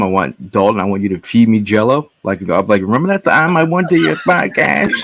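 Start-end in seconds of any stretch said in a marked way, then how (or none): 2.8-4.9 put-on high-pitched reminiscing old-timer; imagined nursing-home resident. Nursing-home resident reminiscing to caretaker, high put-on voice. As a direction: play an old resident recalling glory days, pitched way up.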